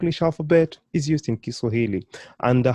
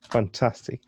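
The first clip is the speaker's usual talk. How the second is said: normal talking voice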